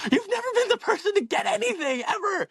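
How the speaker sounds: very high mock mother's voice with a laugh; mocking mom. Son mimicking his mother's doubts, high laughing mock voice. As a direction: squeak out mom's scorn, laughing. Mom scolding him, retold.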